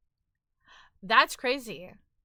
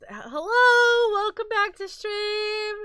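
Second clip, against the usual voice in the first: excited squeal; 12 semitones higher, 10 dB louder, slower than usual. Real, not acted. Loud overexcited greeting.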